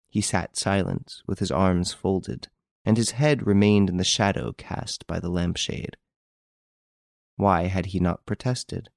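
ordinary delivery

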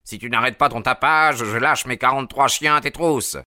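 irate householder driving someone away; growled threat. A narrator's reading. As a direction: threaten an unwanted caller from upstairs. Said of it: "gronda une voix".

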